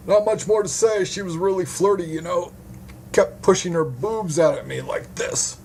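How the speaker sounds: high-pitched hapless witness, chatting away; duped male witness, chatty. Acted. Witness explains to detective about the flirty woman, high chatty voice.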